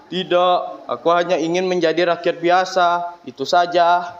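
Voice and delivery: a hint of a calm old sage declining; Abu Nawas voice. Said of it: "Tapi Abu Nawas berkata".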